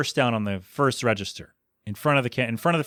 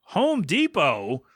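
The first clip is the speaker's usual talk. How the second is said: slow, drawn-out mock indignation; angry, clear as day; 3 semitones higher, much slower than usual. Angry customer repeats the store's name, slowly indignant. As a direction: enunciate it like an offended customer. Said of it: "in, like, clear as day".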